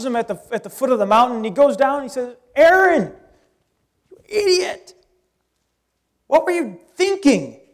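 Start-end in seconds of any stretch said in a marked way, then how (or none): 2.6-7.6 angry yell. Retold or imitated. Moses yelling at Aaron over the calf, furious. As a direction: scold Aaron like a furious older brother. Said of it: "and Moses is angered".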